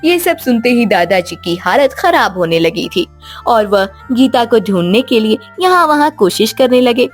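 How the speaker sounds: usual speaking voice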